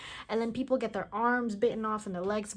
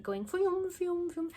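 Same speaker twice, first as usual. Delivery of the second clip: high pulsing sonar sound effect; sonar noise imitation; 8 semitones higher, slower than usual. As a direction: mimic a sonar ping.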